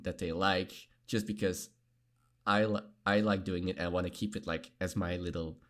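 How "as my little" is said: normal talking voice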